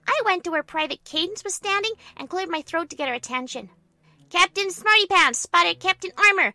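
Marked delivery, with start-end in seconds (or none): 4.3-6.5 loud command building to a shout; brief the soldier, then call out the order. Acted. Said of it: "I ordered".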